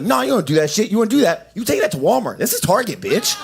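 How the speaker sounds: high, indignant retort, hammed up; mock scolding. Acted scolding of woman in Target.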